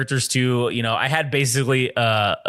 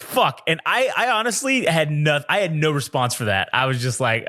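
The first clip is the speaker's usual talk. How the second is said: usual speaking voice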